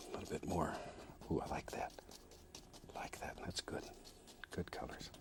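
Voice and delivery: hushed admiring whisper. Real, not acted. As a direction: whisper it like a private delight. Admiring his own strokes.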